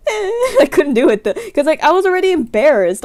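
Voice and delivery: high laughing through the words; laughing at her own misery; laugh-crying. Real, not acted. Telling of freezing up before her sister, half laughing.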